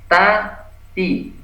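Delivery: "ta, ti" with a light, thin T. Shown as a demonstration. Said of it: "Nah, tipis".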